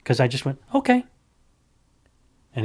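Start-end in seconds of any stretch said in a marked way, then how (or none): 0.7-1.0 high, loud, breezy "Okay"; a calm, breezy "Okay" to a man threatening a fight; bright unfazed okay. Retold or imitated. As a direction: toss off "Okay" cheerfully as if nothing's wrong. Switch flipped calm okay.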